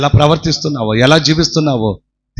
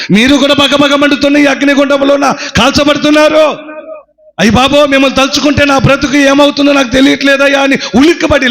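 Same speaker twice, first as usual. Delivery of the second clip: much higher, loud anguished wail; horrified lament; 10 semitones higher, 3 dB louder than usual. Acted. Mother sees even the pastor burning, horrified wail. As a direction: cry out in horror and dread.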